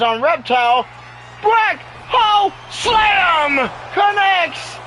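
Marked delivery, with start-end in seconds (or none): 1.4-4.8 slow, drawn-out shout, much higher; hyped commentator shout; calling the big move. Real, not acted. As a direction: belt out the move name like a ringside caller.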